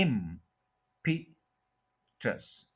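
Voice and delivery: Impetus very slowly as IMM... pi... tus; impetus broken into three slow pieces; syllable by syllable. Shown as a demonstration. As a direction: hold IM in impetus, pause between syllables. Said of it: "इम पे stress करना है".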